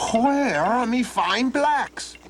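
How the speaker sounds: put-on high, slow demanding old man. Old man asks Donald where his horses are, high and slow. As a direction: drag it out suspiciously.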